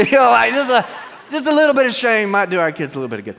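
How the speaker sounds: loud, laughing, a bit fast; laughing punchline. Real, not acted. Preacher laughing at his wife's joke to the congregation, loud. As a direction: crack up at the joke.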